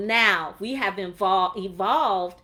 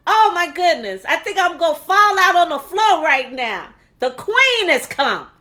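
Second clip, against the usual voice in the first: high, loud, bursting with excitement; overjoyed exclaiming; 6 semitones higher, 8 dB louder than usual. Real, not acted. Host fussing over an honored guest's arrival, overjoyed. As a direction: gush at full volume, stretching the last words.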